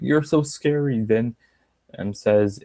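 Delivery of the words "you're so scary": sarcastic, higher "You're so scary."; sarcastic "so scary" lifted higher; mock fear. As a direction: taunt the rival by pretending to be scared. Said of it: "and then Brooke was like".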